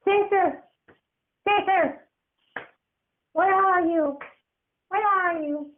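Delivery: put-on high kid voice calling out; a frightened child searching; high boyish shouts for sister; little boy calling.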